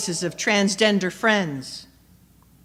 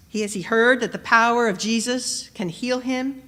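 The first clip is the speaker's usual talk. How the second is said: usual speaking voice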